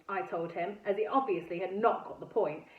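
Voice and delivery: ordinary delivery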